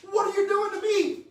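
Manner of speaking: high, loud, incredulous cry; mock outraged disbelief. Senator retelling his shock at the punishment, high incredulous cry. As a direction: throw up your hands in disbelief. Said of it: "I'm like".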